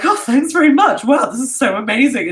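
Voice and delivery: high, loud naive delight; mock gushing. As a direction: overdo the thrilled tourist thanks. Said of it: "we were like".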